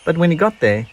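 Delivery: ordinary delivery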